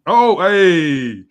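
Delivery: loud, drawn-out exclamation; excited reaction. Real, not acted. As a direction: react with a big loud whoop of recognition. Teasing reaction to player's named rival.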